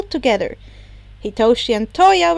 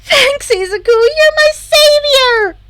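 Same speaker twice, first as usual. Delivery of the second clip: much higher, gushing gratitude; bubbly relief after being saved; excited thanks; 13 semitones higher, 8 dB louder than usual. Acted. Himiko thanking Izuku for killing the creeper, gushing.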